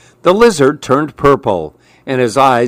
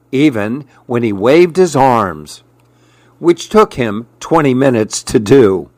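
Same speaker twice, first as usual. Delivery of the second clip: ordinary delivery, nothing marked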